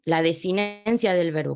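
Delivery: ordinary delivery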